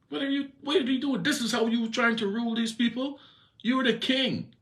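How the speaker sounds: very high, mocking scold; Jezebel's voice. Queen Jezebel berating sulking Ahab, very high mocking voice. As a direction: taunt him over and over, you are the king.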